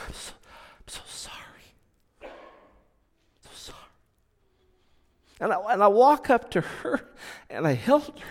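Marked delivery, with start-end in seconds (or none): 0.1-3.8 breathy whispered apology, barely voiced; tearful whisper. Boss apologising to laid-off worker, tearful whisper. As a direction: whisper it through tears, ashamed. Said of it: "she was in tears".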